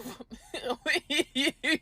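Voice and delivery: amused laugh. Real, not acted. Woman amused by her own tale, laughing. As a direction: burst of giggly laughter.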